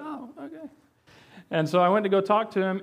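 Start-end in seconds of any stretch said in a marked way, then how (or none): 0.0-0.7 a high, soft mock "oh, okay"; much higher, quieter surprised reply; mock surprise. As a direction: lightly act out being caught off guard. Retelling his reply to coworkers.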